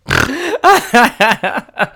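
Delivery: high, loud burst of laughter; laughing hard. Real, not acted. Man cracking up at his own story, laughing. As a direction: crack up helplessly.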